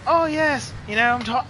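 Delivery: high-pitched put-on rider voice; Ken Roxon. Streamer quoting a pro rider to chat, mock impression. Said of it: "I'm gonna give him an Australian accent".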